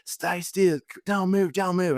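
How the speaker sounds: high-pitched mock Australian accent; Australian paramedic accent. Australian paramedics to the crash victim, high mock Australian accent. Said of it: "in their Australian accents, paramedics".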